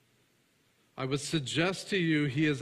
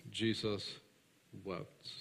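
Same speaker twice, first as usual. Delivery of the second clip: hushed; 5 semitones lower, 7 dB quieter, much slower than usual. Acted. Solemn reading of Jesus weeping.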